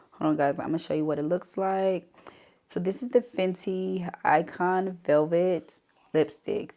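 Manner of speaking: ordinary talk, nothing marked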